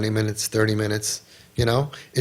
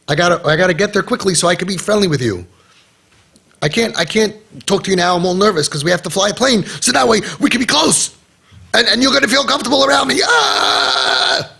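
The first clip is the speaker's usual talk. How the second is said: mocking nervous father; 5 semitones higher than usual. Mocking the father who rushed to the airport, frantic and building to a shout.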